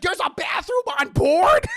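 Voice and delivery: high, very loud disbelieving question; amazed shout. Retold or imitated. Imitating Peter amazed by the plane, high loud shout. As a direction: shriek it like an astonished kid.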